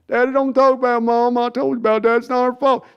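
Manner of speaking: high mock son defending mom; hurt son standing up to dad; imitating Jerry's son. Son defends his mother to Jerry, high mock voice. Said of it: "Jerry and Sons impression".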